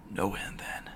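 unvoiced hushed whisper; whispered reply. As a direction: hiss it under your breath at the speaker. His reply to the intercom.

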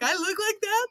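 high, crying whine of shock at his own face; mock crying disbelief. Comedian crying at his reflection in the mirror, mock sobbing. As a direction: sob it out, amazed at how you look.

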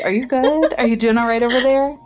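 put-on concerned boyfriend. Boyfriend checking on his crying girlfriend, high and concerned. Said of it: "He's like".

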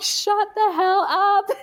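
high-pitched mock exasperated outburst; retold snapping. Labouring wife to chattering husband, mock exasperated snap. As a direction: imitate losing patience, pitched well up.

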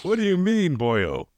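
hammed-up Irish brogue; Irish accent. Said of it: "who's doing a fantastic Irish accent".

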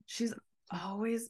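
hushed gossip whisper; breathy secretive aside. Real, not acted. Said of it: "whispered gossip".

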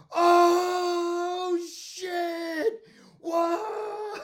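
huge, high, drawn-out holler; crowd's shocked roar. Retold or imitated. Storyteller as party crowd seeing the soaking, long mock roar. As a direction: imitate a whole party yelling in shock. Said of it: "we heard the whole crowd at the party go".

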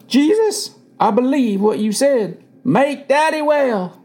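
high, slow, earnest prayer; praying. A narrator's reading. Boy praying over his sick father, high and pleading. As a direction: pray it slowly, pitched well up. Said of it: "I prayed".

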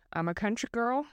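playful Southern twang with a rising end. Shown as a demonstration. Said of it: "I just did, like, a Southern twang".

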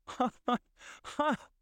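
acted, bursting "ha ha ha"; splendid laugh. A narrator's reading. Scrooge laughing after years out of practice, splendid laugh. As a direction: laugh out in separate ha's, jumping high. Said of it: "it was a splendid laugh".

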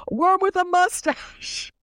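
high, slow, giggling jab; laughing while mocking Sandoval; laughing insult. Real, not acted. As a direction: sneer it through laughter.

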